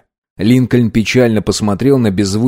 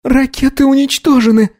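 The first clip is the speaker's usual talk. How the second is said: raised, high clear report; loud announcement. Acted. Sandra announcing to the crew, loud and high. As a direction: call it across the bridge. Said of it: "громко произнесла Сандра".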